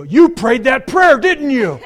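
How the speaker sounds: loud, high and slow, half laughing; a loud, drawn-out gotcha to his wife; slow, loud realization: you prayed that prayer; excited accusation. Retold or imitated. Retold realization, calling out to wife.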